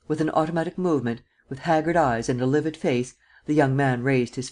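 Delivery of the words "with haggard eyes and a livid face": plain reading voice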